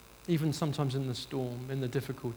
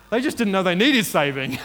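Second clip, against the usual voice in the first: loud, raised, urgent preacher; emphatic preaching; 5 semitones higher, 12 dB louder than usual. Real, not acted. Preacher explaining the safety net parable, emphatic. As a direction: punch the point out to the room.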